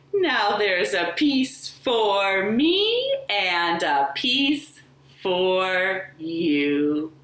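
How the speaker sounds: plain reading voice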